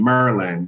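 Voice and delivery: "Maryland" said like "Merlin"; local Maryland. Shown as a demonstration. Co-host repeating the local DC-area way of saying it. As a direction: swallow "Maryland" into "Mer-lund". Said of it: "That's how they say it here".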